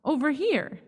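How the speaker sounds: high call across the store. A narrator's reading. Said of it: "I shout".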